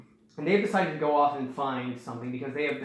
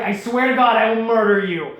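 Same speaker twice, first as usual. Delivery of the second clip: loud, raised angry threat; furious threat; 5 semitones higher, 8 dB louder than usual. Retold or imitated. Hakan threatening the pirate who threw him, angry yell. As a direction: yell it at the traitor while running. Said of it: "and Hakan's like".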